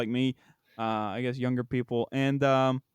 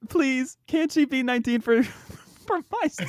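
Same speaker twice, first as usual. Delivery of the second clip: high, pleading through laughter; laughing plea; 10 semitones higher than usual. Real, not acted. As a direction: beg half-joking, laughing it out. Begging while laughing.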